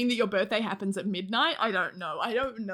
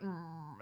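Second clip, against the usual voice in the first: imitated cat grumble; 4 semitones lower, 12 dB quieter than usual.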